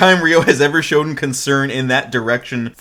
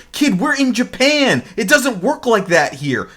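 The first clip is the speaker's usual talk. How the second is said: imitated yelling; 9 semitones higher than usual. Cowdy telling off the kid in Japan, mock yelling. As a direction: scold the kid in a raised pitch. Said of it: "Cowdy's over here yelling at him".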